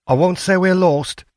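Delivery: light, lifted tone admitting they're lost; middle-aged woman. A narrator's reading. Wife telling strangers they're not lost, lifted woman's voice.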